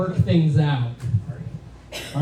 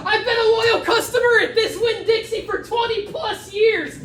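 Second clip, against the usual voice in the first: high-pitched hammed-up scream. Loyal customer ranting in a Florida public space, high acted scream. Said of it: "start screaming out".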